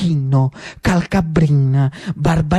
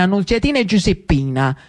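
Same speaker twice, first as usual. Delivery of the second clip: ordinary delivery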